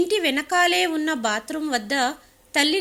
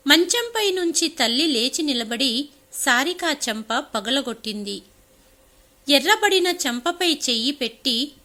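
usual speaking voice

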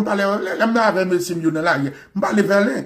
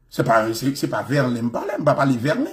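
ordinary delivery

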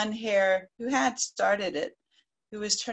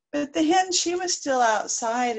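ordinary delivery, nothing marked

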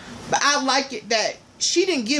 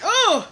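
disgusted ugh; 10 semitones higher than usual. Real, not acted. Disgust at word diet.

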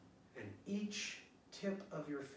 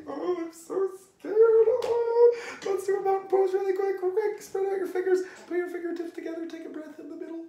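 much higher, louder hammed-up panic, hurried; mock scared; 17 dB louder than usual. Shown as a demonstration. Teacher acting scared for the kids, hammed-up high panic. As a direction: squeal like a pretend scared kid. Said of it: "Show me a scared body".

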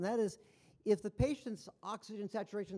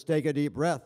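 loud, lower machine command; machine voice prompt; 4 semitones lower, 9 dB louder than usual. Retold or imitated. Speaker imitates the device prompting the patient, machine voice. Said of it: "it kind of does this".

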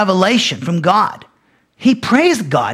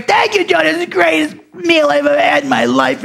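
hammed-up high-pitched thanks; mock gushing praise; 7 semitones higher than usual. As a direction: overdo the gratitude in a high voice. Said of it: "he just showers Jody with praise".

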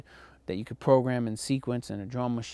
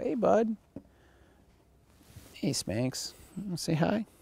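pet talk; 7 semitones higher than usual. Real, not acted. Greeting his pet mid-lecture.

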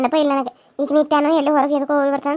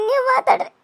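higher, sobbing plea with ನೀವು pushed up; crying; 7 semitones higher than usual. Acted. Daughter-in-law pleads with her mother-in-law, weeping with ನೀವು raised. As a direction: beg them through tears, stressing ನೀವು. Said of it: "ಅತ್ಕೊಂತ ಹೊಂಟಿದಿ".

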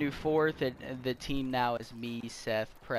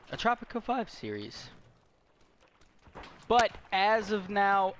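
ordinary talk, nothing marked

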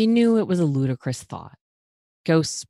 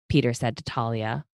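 usual speaking voice

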